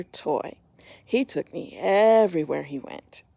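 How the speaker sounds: gentle storybook narration; reading to children. A narrator's reading. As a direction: tell it like a bedtime story to little ones. Reading picture book aloud.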